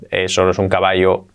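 eso no es un caballo in a low monotone; without intonation. Shown as a demonstration. Teacher showing learners how not to speak, toneless. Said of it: "no habléis".

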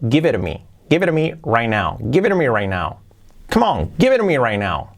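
"Give it to me" run together as "gibe ɾ mi"; American flap. Shown as a demonstration. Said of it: "la pronunciación que sería correcta sería así".

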